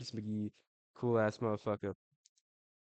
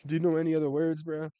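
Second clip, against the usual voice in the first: louder, higher incredulous question; raised-voice, exasperated acted quote; mock exasperation; 6 semitones higher, 6 dB louder than usual. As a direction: act out losing patience with a one-word answerer. Acted exasperated reply in imagined conversation.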